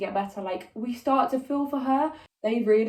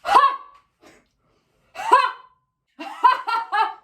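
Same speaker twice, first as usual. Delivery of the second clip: high burst of laughter; laughing; 5 dB louder than usual. Real, not acted. Laughing at her own terrible impression. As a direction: crack up, then sharp high "ha"s.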